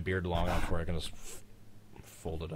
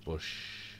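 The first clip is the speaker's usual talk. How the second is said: softer Bush with a long held sh; drawn-out "Bush"; 5 dB quieter than usual. Shown as a demonstration. Streamer showing friends his Bush competition, drawn-out sh. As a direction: stretch the sh in Bush like a breath contest. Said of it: "last one to run out of breath".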